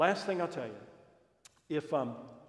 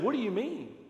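surprised question; 9 semitones higher, 6 dB louder than usual. Retold or imitated. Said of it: "And I said".

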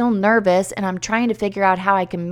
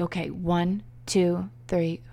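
nervous countdown; 2 semitones lower, 9 dB quieter, slower than usual. Retold or imitated. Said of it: "But I was like".

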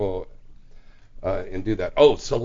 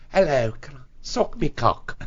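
put-on Welsh accent; 3 semitones higher than usual. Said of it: "she's got that Welsh accent".

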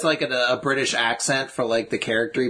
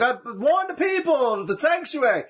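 high, drawn-out mock proclamation; mock prophet of warning; 11 semitones higher than usual. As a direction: cry out a warning like a hammy doomsayer. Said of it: "You're not gonna be able to be like".

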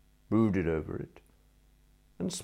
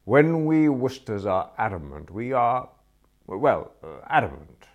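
firm and pompous; 4 semitones higher, 7 dB louder than usual. A narrator's reading. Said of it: "I said firmly".

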